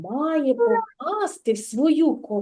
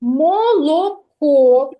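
drawn-out echo; 6 dB louder, much slower than usual. Shown as a demonstration. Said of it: "teacher echoes answer".

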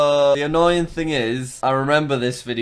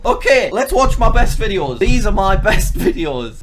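louder, higher hammed-up excitement, with a laugh; mock hype; 6 semitones higher, 7 dB louder than usual. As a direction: sell it like a big event. Hyped opening of his old videos.

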